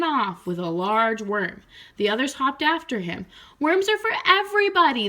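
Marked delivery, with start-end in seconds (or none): 3.6-5.1 much higher, louder, fast; indignant frog chorus; frogs crying out. Acted. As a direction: cry out in protest. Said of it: "they cried".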